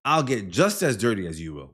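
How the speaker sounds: usual speaking voice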